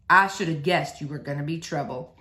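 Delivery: a hint of a father's mock complaint; resigned preacher.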